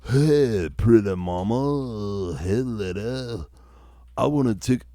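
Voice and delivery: slow, drawn-out surfer dude flirting. Acted. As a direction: lay on a lazy surfer-dude come-on. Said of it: "it's more of a surfer dude thing".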